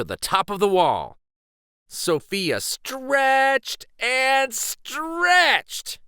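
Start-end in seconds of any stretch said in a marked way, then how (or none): 1.9-6.0 high, very slow, strained reach; straining to reach. A narrator's reading. Narrator acting out Sophia reaching up the wall, straining. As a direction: stretch the words like reaching for a hold.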